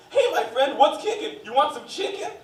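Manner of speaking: drive-thru worker greeting with a rhyme; enthusiastic drive-thru rhyme. Retold or imitated. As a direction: recite the rhyme like a cheery employee. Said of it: "a weird little rhyme".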